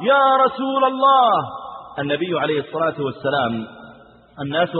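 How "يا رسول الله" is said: urgent call. Acted. Man in the mosque calling the Prophet, urgent raised cry. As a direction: call out to interrupt the sermon. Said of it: "أخذ يصيح بأعلى صوته".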